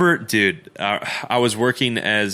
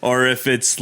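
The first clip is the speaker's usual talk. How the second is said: ordinary talk, nothing marked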